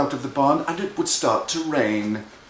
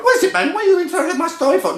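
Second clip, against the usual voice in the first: high, loud, rapid squeal; annoyed little brother; 5 dB louder, much faster than usual. Acted. Little brother snaps at being interrupted, high and fast. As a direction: burst out indignantly at being cut off.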